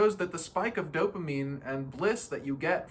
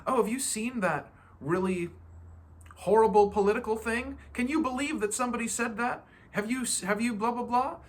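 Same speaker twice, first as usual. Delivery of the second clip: higher, eager gossip voice, lightly done; gossipy friend; 7 semitones higher than usual. Retold or imitated. A friend pushing bad political news, mock gossip. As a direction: play the friend itching to share bad news. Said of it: "somebody else will say".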